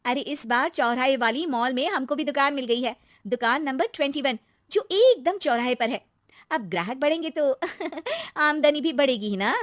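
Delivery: excited daughter-in-law. Acted. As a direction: brag cheerfully to the aunty. Narrator voices Shweta. (खुशी से बताती बहू)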